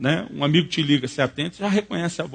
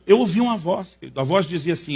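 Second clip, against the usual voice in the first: normal talking voice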